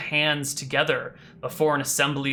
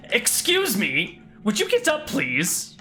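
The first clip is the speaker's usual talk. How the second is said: prim and refined, pitched up; very refined person; 6 semitones higher than usual. Acted. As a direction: play a refined gentleman waking sleepers. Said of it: "this very refined person saying".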